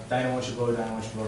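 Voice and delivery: low, soft aside; gossiping. Shown as a demonstration. Workmen gossiping to each other about Dinah, low and soft. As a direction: drop the voice as if passing on gossip. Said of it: "almost like gossiping to each other".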